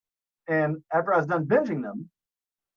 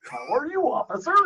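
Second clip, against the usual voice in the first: higher mock courteous citizen; fake polite voice; 9 semitones higher than usual. Host mimicking pulled-over driver, fake polite voice. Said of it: "use it when I get pulled over".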